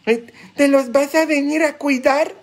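high-pitched mock challenge to the priest; put-on high voice, indignant question; mimicked complaining parishioner. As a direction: throw the question back as a protesting parishioner. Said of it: "Cuántas vienen conmigo y dicen".

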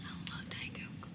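slow hushed whisper. Real, not acted. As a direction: whisper it slowly and softly.

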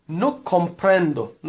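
no comprendo, every syllable drawn out; teacher models no comprendo slowly; slow model phrase. Shown as a demonstration. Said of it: "تکرار بفرمایید".

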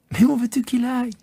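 high, laughing question; amused child. Acted. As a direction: toss it back playfully.

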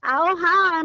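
normal talking voice

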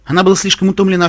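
ordinary delivery

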